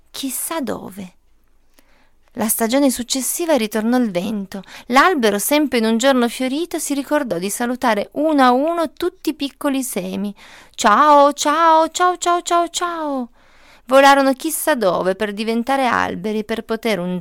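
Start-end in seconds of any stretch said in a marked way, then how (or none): 0.1-1.1 fading away. A narrator's reading. Seed falls, fading away. 10.7-13.3 cheerful goodbyes. Acted. Tree waving off the flying seeds, higher goodbyes fading out.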